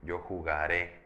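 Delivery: "jugaré" with the last syllable stressed and rising; stress on final É. Shown as a demonstration. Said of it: "me estoy yendo a futuro de indicativo".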